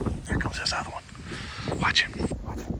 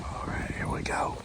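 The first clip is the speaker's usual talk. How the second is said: whispering; 6 dB quieter than usual. Real, not acted. Hunting blind, waiting for deer.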